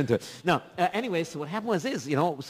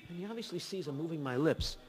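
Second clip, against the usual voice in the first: ordinary delivery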